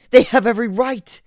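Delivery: high, loud cry of despair; anguished outburst. Acted. Griffin despairing that nobody believes him, loud. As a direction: let it break out of you.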